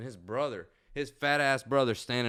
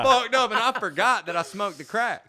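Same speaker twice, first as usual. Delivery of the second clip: higher and louder, amused; animated raised retelling; excited; 10 semitones higher, 9 dB louder than usual. Real, not acted. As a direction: pump it up like the punchline.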